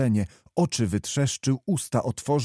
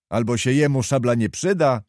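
plain reading voice

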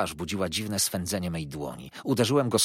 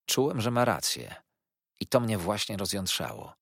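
ordinary delivery

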